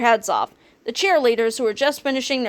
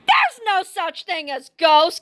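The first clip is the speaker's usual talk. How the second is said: yell; 7 semitones higher, slower than usual. A narrator's reading. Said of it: "Kipper yelled".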